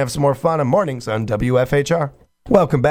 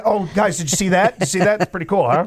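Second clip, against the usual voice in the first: higher, showing off to the crowd; mock self-absorbed host; 6 semitones higher than usual. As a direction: play the vain host bragging to the band. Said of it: "look at my new earring".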